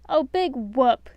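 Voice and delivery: slow sarcastic drawl; dismissive scoff. A narrator's reading. Grumpy mocking Snow's sad story, sarcastic. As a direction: roll your eyes at it. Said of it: "Grumpy said".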